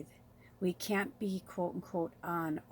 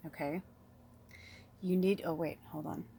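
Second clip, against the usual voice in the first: ordinary talk, nothing marked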